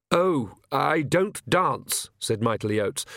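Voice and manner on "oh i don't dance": slow, higher priest's voice; hesitant, drawn-out cleric; timid priest declining. A narrator's reading. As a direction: draw out a prim refusal.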